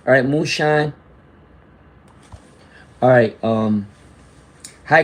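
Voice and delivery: usual speaking voice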